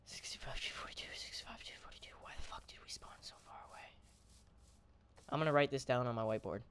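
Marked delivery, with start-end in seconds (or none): 0.0-3.8 whispering. Real, not acted. Repeating coordinates under breath.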